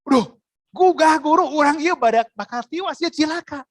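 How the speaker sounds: frightened disciples crying out to Jesus in the storm; panicked cry. Retold or imitated. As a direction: cry out to the teacher in terror.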